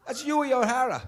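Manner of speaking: high, loud retold Irish fan; Irish pub guy. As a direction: belt it out like the proud Irish fan at the bar. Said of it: "He goes".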